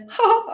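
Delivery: high-pitched mock gasp; imitated startled gasp. Retelling Jen's reaction to the glasses, imitated gasp. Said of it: "Jen was just like".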